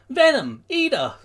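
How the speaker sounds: high, loud name calls; alarmed yell. Acted. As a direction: yell both names in alarm. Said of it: "Midoriya yells".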